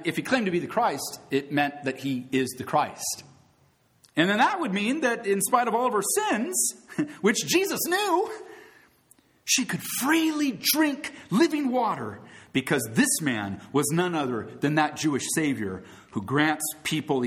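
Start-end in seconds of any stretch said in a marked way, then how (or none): 4.1-13.2 raised, fervent preaching voice; soapbox orator. Acted. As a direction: proclaim it from the pulpit. Preaching at full force.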